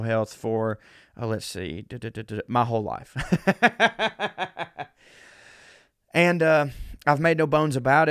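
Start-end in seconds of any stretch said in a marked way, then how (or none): 3.2-4.8 laughing. Real, not acted. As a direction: open laugh at his own joke. Laughs at own lifelong-struggle joke.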